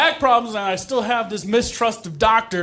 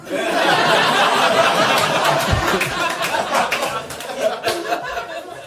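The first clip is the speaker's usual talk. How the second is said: crowd laughter; 7 semitones higher than usual. Real, not acted. Audience laughs at joke.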